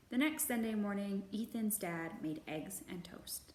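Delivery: put-on gentle, measured read-aloud for children; storybook narration. A narrator's reading. Librarian reading a picture book to kids, gentle narration.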